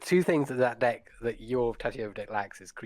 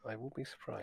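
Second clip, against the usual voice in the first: much softer dry repeat; deadpan echo; 15 dB quieter than usual. Retold or imitated. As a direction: mutter it again under your breath. Herhaalt droog zijn eigen antwoord.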